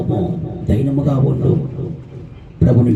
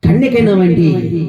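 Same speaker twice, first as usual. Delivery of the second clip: usual speaking voice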